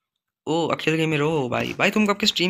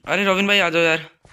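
loud, raised call to a friend; 4 semitones higher, 6 dB louder than usual. Real, not acted. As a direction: call your mate over, loud and higher. Calls a fellow player over.

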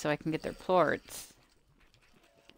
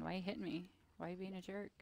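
soft unvoiced whisper, much softer; whispered slime voice; 15 dB quieter, faster than usual. Gamer voicing slimes he hits, softer whisper. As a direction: hush it out like a little creature's complaint. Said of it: "They're probably saying".

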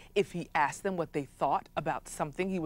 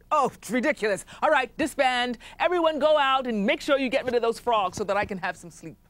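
irritable chief ranting; 5 semitones higher than usual. Acted. Chief dismisses council.

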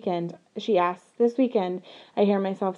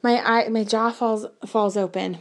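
ordinary delivery

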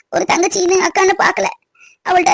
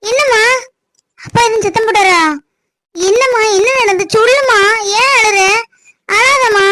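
higher, urgent worried questions; alarmed and pleading, pitched up; frightened concern; 3 semitones higher than usual. Acted. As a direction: plead with a crying mother to explain. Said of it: "பயமா இருக்கு நீ அழத பார்த்தா".

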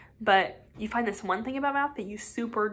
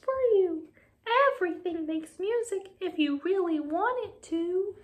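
much higher, kindly cricket; gentle cricket voice; 9 semitones higher than usual. Acted. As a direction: voice a tiny wise cricket. Cricket voice held.

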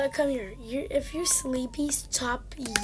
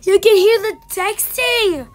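high, loud triumphant cry; excited shout; 6 semitones higher, 12 dB louder than usual. Real, not acted. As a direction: shout it out, vindicated. Excitedly proves the texting sound.

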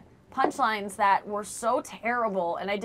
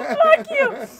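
high, tearful, loud fuck you; crying girlfriend curses the comedian across the bar; 9 dB louder, slower than usual. Retold or imitated. Tearful furious yell. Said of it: "She's like".

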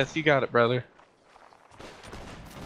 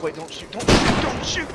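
panicked surrender shout; 8 semitones higher than usual. Acted. Panicking as enemies advance.

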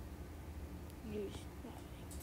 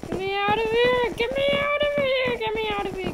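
high, very loud frantic yelling; panicked shout; 10 semitones higher, 11 dB louder than usual. Real, not acted. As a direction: yell it over and over in rising panic. Panics at terrifying spot in game.

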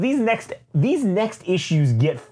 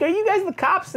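high-pitched anxious question; high hopeful lady. Retold or imitated. Frightened woman asking soldiers if they're police, high anxious voice.

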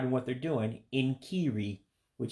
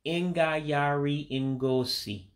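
Ingayari Ngosi spelt out slowly and carefully; 3 semitones higher, much slower than usual. Shown as a demonstration. Teacher to learners, saying the word for carnival slowly. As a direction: take Ingayari Ngosi slow, every syllable clear. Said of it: "just take it slow".

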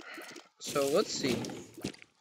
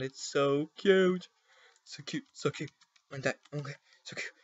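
cooing; 2 semitones higher than usual. Real, not acted. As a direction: coo it like over a puppy. Cooing over cute item.

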